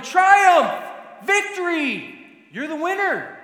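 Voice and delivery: loud, high-pitched acted cheers; crowd yelling. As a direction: shout it out like a street crowd hailing a champion. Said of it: "And they're yelling".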